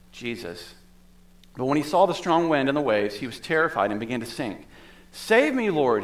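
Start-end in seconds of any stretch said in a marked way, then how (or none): 5.1-6.0 mild shout. A narrator's reading. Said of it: "he shouted".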